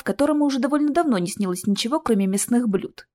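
ordinary delivery, nothing marked

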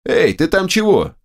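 high call down a shaft. A narrator's reading. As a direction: call out into the pit below. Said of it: "крикнул я вниз".

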